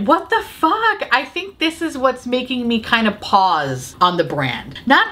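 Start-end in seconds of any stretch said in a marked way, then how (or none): 0.0-1.1 high, slow, stunned disbelief; incredulous outburst. Real, not acted. Reviewer stunned the palette flopped, incredulous outburst. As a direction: drag it out in shock.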